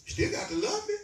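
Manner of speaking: climbing preacher's cry on still got to love me; soaring preacher's cry. Shown as a demonstration. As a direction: fling it up to the rafters. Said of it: "when I tell them".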